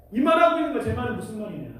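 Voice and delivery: ordinary talk, nothing marked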